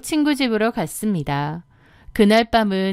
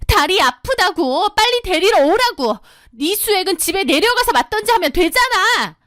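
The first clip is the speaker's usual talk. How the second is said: whiny sister-in-law; 9 semitones higher than usual. Acted. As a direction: drag out the complaint. Sister-in-law whining demands.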